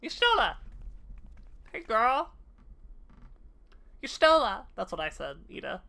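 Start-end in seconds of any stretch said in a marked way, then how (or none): 0.0-2.3 loud call of a name, falling at the end. Real, not acted. Streamer calling out to Y'shtola, loud. 4.0-4.7 loud call of a name, falling at the end. Real, not acted. Streamer calling out to Y'shtola, loud.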